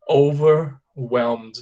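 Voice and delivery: OVERWHELMED drawn out in two parts; slow model pronunciation. Shown as a demonstration. Teacher shows the word on its own, slowly. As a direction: stretch "Overwhelmed", gap after "over". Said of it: "Apenas assim".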